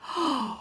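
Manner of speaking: a soft startled intake of breath at the sight; gasp. Acted. Blind man seeing the market for the first time, gasping.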